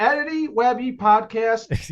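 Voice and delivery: slow, drawn-out show plug; hammed-up radio tagline; mock announcer. Plugging the podcast like a broadcaster, slow mock announcer.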